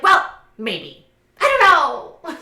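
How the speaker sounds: loud coy hedge jumping to a high wail; hammed-up dithering, ending in a squeal; mock indecisive ex. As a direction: imitate her dramatic wavering. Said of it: "And she's like".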